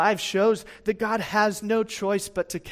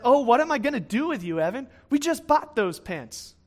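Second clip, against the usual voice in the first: higher-pitched, louder mock-exasperated parent; 5 semitones higher, 5 dB louder than usual. Parents to their son over ruined pants, mock exasperation. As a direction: imitate a parent at wits' end, pitch raised.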